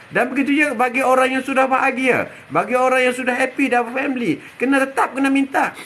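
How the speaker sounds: normal talking voice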